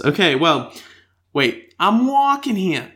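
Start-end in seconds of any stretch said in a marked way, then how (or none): 1.8-2.9 bad attempt at a famous actor's accent; Christopher Walken. Host trying an accent before reading listener mail, badly done Walken. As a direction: have a clumsy go at the Walken accent. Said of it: "I cannot do a Christopher Walken accent".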